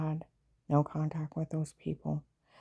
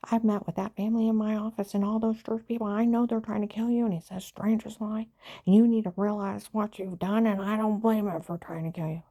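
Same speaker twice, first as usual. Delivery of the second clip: higher and louder, worked up; agent's threat retold higher and louder; retold heated agent; 5 semitones higher, 5 dB louder than usual. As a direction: spill it out indignantly.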